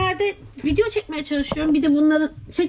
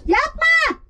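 annoyed yell; 9 semitones higher, 4 dB louder than usual. Real, not acted. Scolding someone who keeps shaking it.

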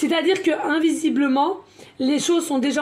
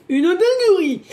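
higher and louder, delighted; thrilled exclamation; excited burst; 5 semitones higher, 6 dB louder than usual. Real, not acted. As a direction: blurt it out with glee. Raving about her inspiration.

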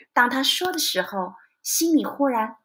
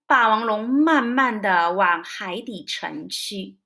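ordinary delivery

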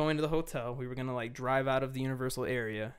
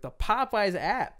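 exasperated; 6 semitones higher, slower than usual. Retold or imitated. Showing the app, exasperated.

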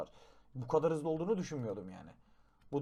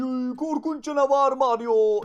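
higher, louder, slow hammed-up terror; mock fright; 8 semitones higher, 9 dB louder, slower than usual. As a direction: pretend to be terrified of the monster. Mock horror at monster egg.

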